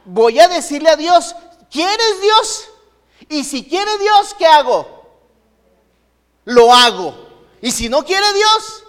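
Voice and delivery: eager prayer. Retold or imitated. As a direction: voice a believer pleading with God, lifted high. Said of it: "Quiero que usted diga".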